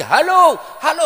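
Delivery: loud, high hello into the handset; mock phone shout. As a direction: yell as if the line is bad.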